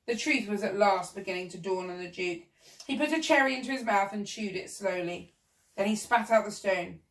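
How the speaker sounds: usual speaking voice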